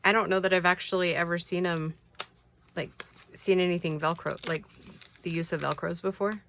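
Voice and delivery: ordinary talk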